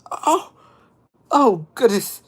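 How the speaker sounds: high, loud mock dismay; retold shock. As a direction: gasp it out, hamming up alarm. Retells shock at villains coming alive.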